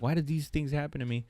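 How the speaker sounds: softly retold self-pity; sad, bummed out. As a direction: sigh it to yourself, understated. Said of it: "sad, bummed out".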